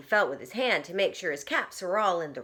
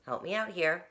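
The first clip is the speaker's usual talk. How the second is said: usual speaking voice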